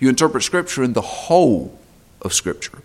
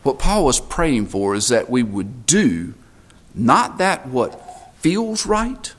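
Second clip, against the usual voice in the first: ordinary talk, nothing marked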